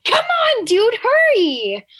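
very high, loud exasperated yell, breaking into laughter; impatient shout. Acted. Young listener to slow audiobook reader, impatient yell. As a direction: yell at a slowpoke in mock exasperation. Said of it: "And I'm like".

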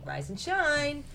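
high, loud bossy mother hen; Mama Chicken voice. A narrator's reading. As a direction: call it out like a brisk mom waking her chick. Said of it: "This is all Mama telling little chicken".

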